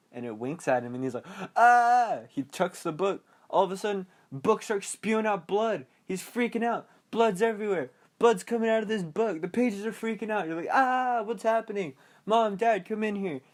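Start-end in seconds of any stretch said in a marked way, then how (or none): 1.6-2.2 loud, high mock scream; mock frightened yell. Bill sees the picture wink, mock startled yell. 3.5-13.4 high-pitched, frantic mock alarm; hammed-up panic. Bill calls Mom and Dad about blood, hammed-up panic. Acts out panic over bleeding book.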